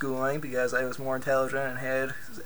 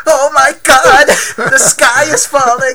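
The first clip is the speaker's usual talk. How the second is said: put-on slow, loud, high panic in German accent; German accent, panicked; 12 semitones higher, 16 dB louder than usual. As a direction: wail it like the sky falls. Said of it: "in his German accent".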